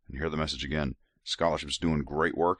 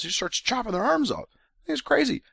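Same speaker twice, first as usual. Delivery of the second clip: much higher, disbelieving outburst; incredulous outrage. Real, not acted. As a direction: voice shooting up in shocked disbelief. Outraged retelling of Alma's violence.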